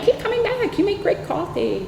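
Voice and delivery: very high, soft cooing voice; mock-encouraging mimicry. AA members to angry newcomer, mimicked high-pitched encouragement. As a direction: imitate sugary meeting-room encouragement.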